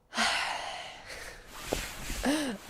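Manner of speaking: long breathy gasp of surprise; startled gasp. Acted. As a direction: gasp as if suddenly touched. Startled by sudden arm on shoulders.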